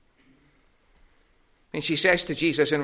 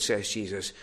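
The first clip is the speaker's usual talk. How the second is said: ordinary delivery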